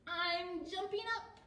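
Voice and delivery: high character call; Fluff's voice. A narrator's reading. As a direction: pipe it up high. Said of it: "said Fluff".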